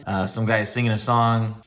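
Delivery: ordinary talk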